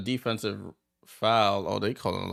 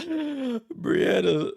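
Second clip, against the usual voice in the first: laughing; 14 semitones higher, 4 dB louder than usual. Real, not acted. Amused reaction to Brianna's fight.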